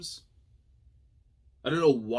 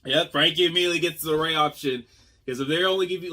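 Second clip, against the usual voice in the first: excited; 6 semitones higher, 9 dB louder, faster than usual. Real, not acted. Hyped reaction to the reveal.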